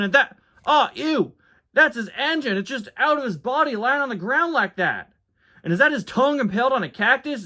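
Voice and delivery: high-pitched, grossed-out country tow truck; Tow Mater. Acted. Mater looks over his friend's wreck, disgusted. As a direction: recoil in squeamish horror. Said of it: "Tow Mater Tries to Convince Someone".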